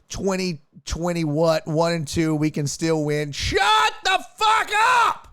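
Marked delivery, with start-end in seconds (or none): 3.3-5.3 high, slow yelled outburst; furious shout. Real, not acted. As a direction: scream it at the screen. Yelling at tweeter.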